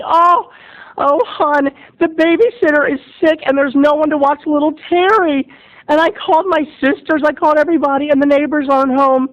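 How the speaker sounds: high fretting parent voice; fussy parents. Retold or imitated. Parents fretting over no babysitter, high and fussy. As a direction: play the frantic parents stuck without a sitter.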